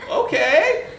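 wary okay. Retold or imitated. Professor asked to put arm out, wary. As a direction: agree with obvious doubt.

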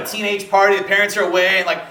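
usual speaking voice

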